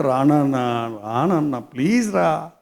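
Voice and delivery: soft, gentle coaxing; pleading. Acted. Father gently begging his elder son to come in. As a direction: coax him in quietly. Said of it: "బతిమలాడుకుంటున్నాడు".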